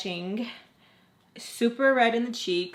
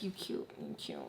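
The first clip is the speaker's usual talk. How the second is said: very soft, low and voiceless; flirty murmur; 16 dB quieter than usual. Retold or imitated. Flirting despite herself, hushed murmur. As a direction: murmur it under your breath. Private thought while flirting.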